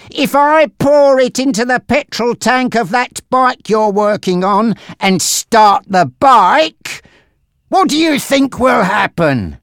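high, forceful intimidation; angry, threatening gangster Frankie. Acted.

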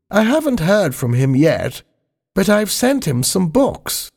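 put-on high, genteel old lady voice; the Queen. The Queen offering her saddle to Spiggy, high genteel voice.

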